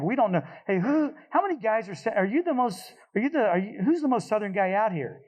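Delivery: high, urgent, frantic questions over the radio; urgent radio call. Retold or imitated. Pilot on the net, urgently demanding who's southernmost. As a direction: press the pilot fast for who is southernmost.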